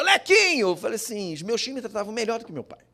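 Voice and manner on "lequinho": high, louder affectionate call. Retold or imitated. Uncle calling his nephew Lequinho, loud and high. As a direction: call the boy's nickname across the street.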